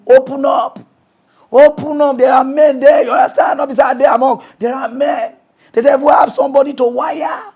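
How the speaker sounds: calling out. Real, not acted. Calling out to the audience.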